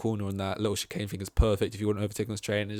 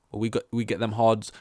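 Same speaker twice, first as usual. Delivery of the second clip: ordinary talk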